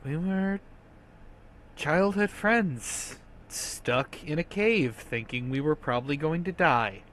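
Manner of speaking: quiet, hesitant, weary explanation; sighing confession. Acted. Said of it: "she sighs and she says".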